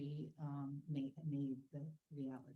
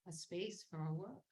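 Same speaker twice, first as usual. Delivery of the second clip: normal talking voice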